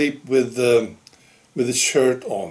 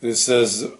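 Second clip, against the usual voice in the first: ordinary talk, nothing marked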